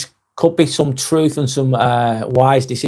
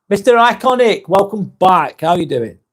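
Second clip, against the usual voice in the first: normal talking voice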